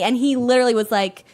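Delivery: ordinary delivery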